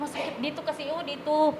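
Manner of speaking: a hint of a small hurting child; weak child's voice. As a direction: voice the sick grandchild barely speaking. Grandchild in pain speaking.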